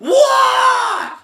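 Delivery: very high, loud shout; shocked shout. Real, not acted. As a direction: yelp in shock. Sudden surprise.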